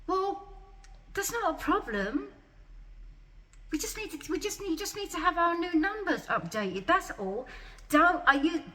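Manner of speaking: high, dismissive imitation of the nurse; mock nurse voice. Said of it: "You know what she said?".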